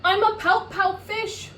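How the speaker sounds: high, loud pout; pouty fish. Acted. Fish introducing himself, loudly glum. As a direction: start the moping refrain. Refrain begins.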